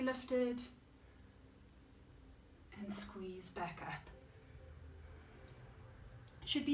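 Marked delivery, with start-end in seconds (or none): 2.8-4.1 hushed cue. Shown as a demonstration. Instructor cueing squat reps.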